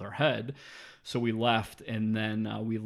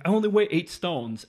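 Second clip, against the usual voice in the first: indignant protest; 8 semitones higher, 8 dB louder than usual. Retold or imitated. Retold woman protesting her weight.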